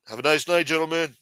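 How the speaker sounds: imitating a dismissive doorman; security guard. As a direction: turn them away like a club bouncer. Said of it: "Big-ass security guards used to say".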